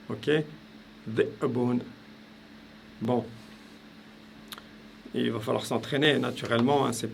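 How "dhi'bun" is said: Dhi'bun with the first syllable cut short at the throat; Dhi'bun broken cleanly by a glottal stop; glottal stop demo. Shown as a demonstration. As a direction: say Dhi, a silent catch, then bun in Dhi'bun. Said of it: "je fais un coup de glotte".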